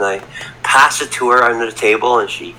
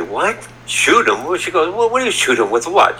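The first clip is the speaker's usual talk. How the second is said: higher-pitched mock disbelief; retold startled questions, pitched up; incredulous mother; 6 semitones higher than usual. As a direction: imitate a mother taken aback, questioning her son. Voicing mother's shock at shooting remark.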